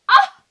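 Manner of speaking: high squeal then gasp; fangirl squeal. Retold or imitated. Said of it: "I'm just like".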